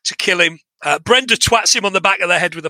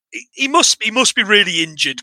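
ordinary talk, nothing marked

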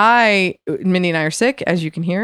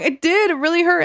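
insistent protest; 9 semitones higher than usual. Retold or imitated. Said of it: "I'm like".

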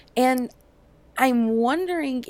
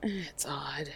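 whispered; 6 semitones lower, 14 dB quieter than usual. Real, not acted. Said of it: "whispered aside".

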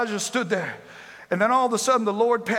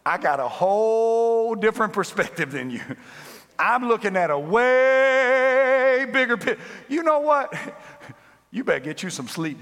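God speaking down; 3 semitones higher than usual. Acted. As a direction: talk down to a tired little man. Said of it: "looking at the earth says".

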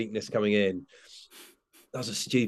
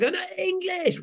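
put-on much higher, a small child insisting; kid's protest. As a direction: imitate a little kid demanding English. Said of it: "Sometimes they go".

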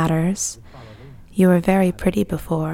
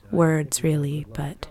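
usual speaking voice

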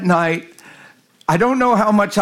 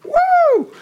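high-pitched mock spooky whoop; mock "woo"; 3 dB louder than usual. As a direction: let out a silly high "woo". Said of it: "I'm not like".

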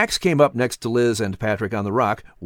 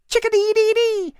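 high, sing-song bird call; chickadee call. Acted. Boy Patrick copying the chickadee with a high chirpy call. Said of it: "he sounded".

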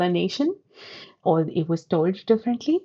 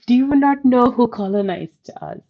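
incredulous retort; 5 semitones higher, 4 dB louder than usual. Retold or imitated. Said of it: "I'm like".

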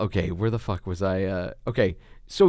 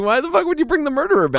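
incredulous outburst; 13 semitones higher, faster than usual. Retold or imitated. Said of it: "But I'm like".

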